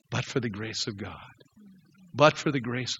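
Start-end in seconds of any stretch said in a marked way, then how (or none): 0.1-1.2 hushed. Shown as a demonstration. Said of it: "Would to God that we would say".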